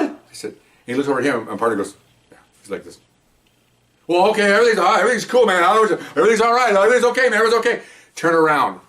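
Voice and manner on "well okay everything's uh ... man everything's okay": much higher, louder and fast, scrambling to calm things; panicked pleading. Retold or imitated. Suspect facing a drawn gun, panicked high pleading. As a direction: babble reassurances to stop him from shooting.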